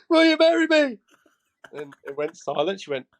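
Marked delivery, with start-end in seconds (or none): 0.1-1.0 high, loud, rushed will you marry me; blurted proposal. Retold or imitated. Proposing on one knee in a rush, loud and high.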